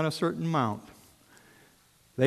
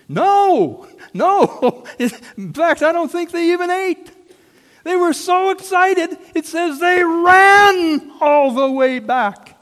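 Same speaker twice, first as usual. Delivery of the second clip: much higher and louder, bursting with joy; excited shout; 12 dB louder, slower than usual. Real, not acted. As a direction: shout it like you can't contain it. Preacher building excitement.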